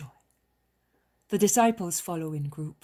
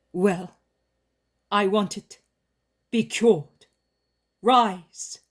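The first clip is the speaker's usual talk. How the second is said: louder, rising command to be cured; 4 semitones higher, 10 dB louder, slower than usual. A narrator's reading. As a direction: order the healing with power. Jesus exerts his heavenly power.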